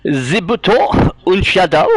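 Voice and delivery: slow, high mock-German reading; attempted German accent. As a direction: try it in German, drawn out. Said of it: "say this thing in German".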